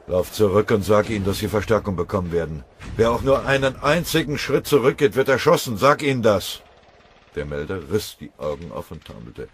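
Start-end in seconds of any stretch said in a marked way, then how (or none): 0.1-6.6 loud, much higher threatening command; harsh order. Acted. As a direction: bark the order to hold or die. Kriegsrat befiehlt dem Melder hart.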